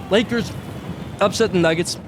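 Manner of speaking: talking through a mouthful of chicken; words pushed round a bite of food; mouth full. Real, not acted. Host on camera talks mid-bite, mouth full of hot chicken. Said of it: "That's hard to talk with food in my mouth".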